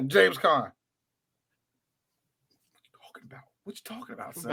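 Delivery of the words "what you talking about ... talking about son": breathy whispered jab. Retold or imitated. Whispering a mock line about James Caan. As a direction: hush it like a secret dig.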